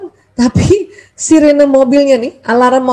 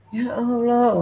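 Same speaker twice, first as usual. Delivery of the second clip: exasperated inner lament; 5 dB quieter, slower than usual. Acted. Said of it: "mulai gitu".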